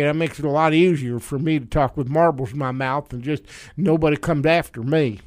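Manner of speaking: Mitch McConnell, marble-mouthed. Acted. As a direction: talk like your cheeks are stuffed with marbles. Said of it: "with my mouth full of marbles".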